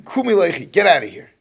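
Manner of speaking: loud retold order to leave; sharp burst of mock command; harsh dismissal. Amnon ordering her out, acted.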